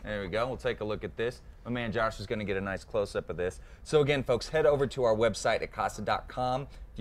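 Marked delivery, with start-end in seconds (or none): none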